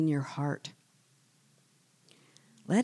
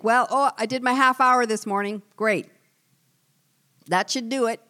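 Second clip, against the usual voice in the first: higher, louder, quick self-satisfied; mocking smugness; 6 semitones higher, 5 dB louder than usual. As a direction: mimic a complacent Bible skimmer. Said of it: "and think".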